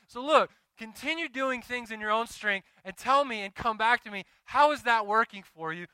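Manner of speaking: loud, rising sermon cadence, driving each phrase home; preaching at full force. Acted. Pastor rallying his church to trust God, preaching loudly. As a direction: preach it to the congregation with fervor.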